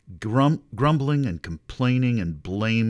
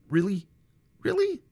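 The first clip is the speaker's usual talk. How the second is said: much higher, mock disbelief; sarcastic double take, pitch flying up; incredulous really; 13 semitones higher, 3 dB louder than usual. Real, not acted. Preacher scoffing at the Israelites' lie, incredulous.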